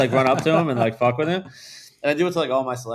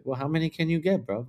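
higher-pitched mock British accent; 7 semitones higher than usual. Tour manager pressing his friend for numbers, mock British accent. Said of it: "he has this British accent".

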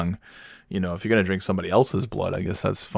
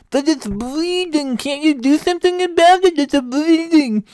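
very high, loud wail with tongue hanging out; tongue-out talk; 5 dB louder than usual. Acted. Husband panicking to wife about his bleeding tongue, high tongue-out wail. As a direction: whine thickly around a stuck-out tongue. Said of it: "I just talked like that".

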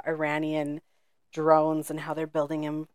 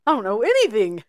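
mock surprise; 5 semitones higher, 10 dB louder than usual. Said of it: "And I was like".

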